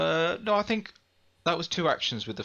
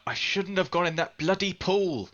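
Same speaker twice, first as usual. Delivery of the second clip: scream; 8 semitones higher than usual. Acted. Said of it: "I scream out".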